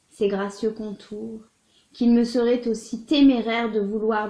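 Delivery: measured, expressive literary reading with swells and pauses; dramatic love-letter reading. Acted. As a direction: read it as an ardent lover's confession. Reads a love letter aloud.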